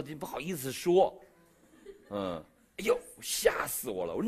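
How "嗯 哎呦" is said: ordinary delivery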